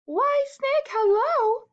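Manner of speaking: very high, bold mouse voice; greeting mouse. Acted. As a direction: call a hello in a high little voice.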